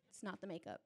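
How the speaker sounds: ordinary talk, nothing marked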